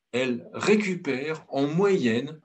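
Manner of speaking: usual speaking voice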